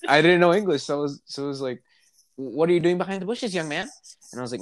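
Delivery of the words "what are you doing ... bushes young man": higher mock teacher voice; imitating the kindergarten teacher, higher. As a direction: play the stern teacher, pitched up. Teacher voiced in retold story.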